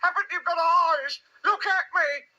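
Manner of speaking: very high, squeaky, slow protest; mild shout. A narrator's reading. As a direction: plead to be seen in a high little voice. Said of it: "shouted Piglet".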